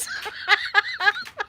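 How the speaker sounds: high giggling fit; real laugh that takes over; helpless laughter. Real, not acted. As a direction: laugh till you can't move. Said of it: "couldn't move myself from laughing".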